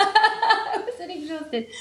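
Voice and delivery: slow, high, laughing denial; laughing protest. Real, not acted.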